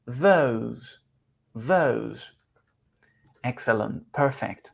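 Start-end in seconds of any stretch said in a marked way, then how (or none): 0.0-2.3 "Those" lower, long oh, ending on a buzzed s; silent final E. Shown as a demonstration. Teacher drills learners on the word, careful pronunciation. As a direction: model "Those" slowly with no vowel after the s. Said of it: "la última E no suena".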